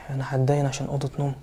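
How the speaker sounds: unvoiced whisper of prayer; whispered despair. Real, not acted. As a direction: breathe it out under your breath. Husband despairing alone. (زوج يائس يستغفر همسًا)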